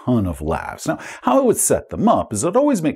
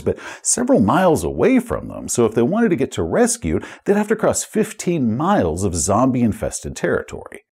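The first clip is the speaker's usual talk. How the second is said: ordinary delivery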